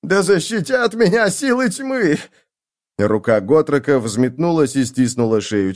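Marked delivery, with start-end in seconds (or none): 0.0-2.2 high-pitched panicked invocation; alarmed cry. A narrator's reading. Agitator recoiling from the dwarf, alarmed high cry. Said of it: "вскрикнул он".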